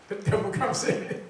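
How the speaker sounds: laughing through the words; chuckling as the guest walks in. Real, not acted. Host jokes as Bernard walks in, laughing. Guest arrives after being mentioned.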